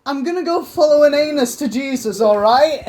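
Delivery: loud, high mock exchange with mum; mock teen to mum. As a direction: play both sides of a silly talk with mum, loud.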